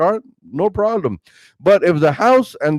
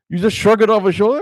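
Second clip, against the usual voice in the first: usual speaking voice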